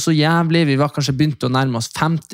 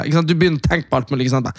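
ordinary talk, nothing marked

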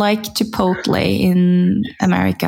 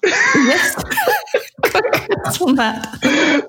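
laughing; 9 semitones higher, much slower than usual. Real, not acted. Laughing at the compliment.

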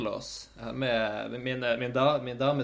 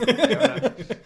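proud, gleeful squeal; excited, high; 12 semitones higher, 8 dB louder, slower than usual. Real, not acted. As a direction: repeat it much higher and louder. Repeats answer, high and loud. (begeistret, mye lysere og sterkere)

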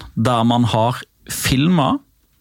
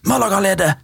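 lie; 8 semitones higher than usual. Retold or imitated. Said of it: "si at".

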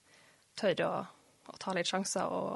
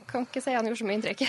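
laughing; 10 dB louder, faster than usual. Real, not acted. Joking about the boss.

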